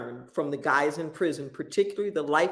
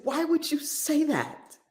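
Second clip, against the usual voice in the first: confronting; 9 semitones higher than usual. Retold or imitated. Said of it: "I just confronted him".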